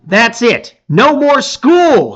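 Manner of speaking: high, loud, stretched cheer; excited shout. Acted. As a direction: yell it out like school just ended. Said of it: "shouts Chark".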